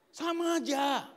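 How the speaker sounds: loud, high drawn-out exclamation; high-pitched preacher's shout; exclaimed punchline. Real, not acted. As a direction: belt it out to the whole room.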